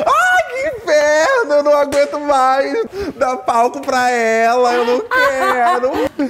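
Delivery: high, loud laughing complaint; half-crying laughing shout; laughing mock outrage. Real, not acted. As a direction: whine and laugh in fake despair. Frustrated about the famous guess.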